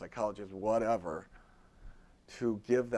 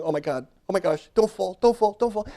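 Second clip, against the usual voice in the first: mock panic; 9 semitones higher, 13 dB louder than usual. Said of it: "you're going".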